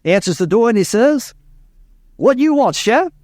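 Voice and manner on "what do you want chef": put-on higher-pitched Cajun farmer accent; old Cajun farmer. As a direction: answer the door as an old Louisiana Cajun. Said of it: "an old Cajun from Southwest Louisiana, farmer".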